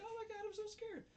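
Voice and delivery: high-pitched squeal of pretend fright; mock scared. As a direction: squeal it like a frightened girl, hammed up. Imitates a girl acting scared.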